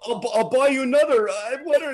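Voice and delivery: high, loud, stammering offer; imitated panic, voice shooting up; mock panicked pleading. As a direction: stammer it like a flustered culprit. Flustered apology to screaming couple.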